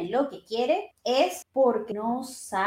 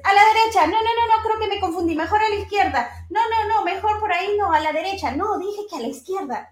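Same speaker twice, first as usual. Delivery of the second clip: flustered dithering driver; 6 semitones higher, faster than usual. Retold or imitated. Confused driver at the wheel, second-guessing himself, high and flustered. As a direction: act out a driver who keeps changing his mind, a touch panicky.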